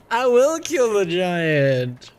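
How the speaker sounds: put-on high, slow Valley Girl drawl; hammed-up Valley Girl Senua. Shown as a demonstration. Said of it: "a Valley Girl voice for every single one".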